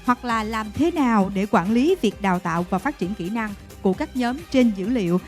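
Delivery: ordinary talk, nothing marked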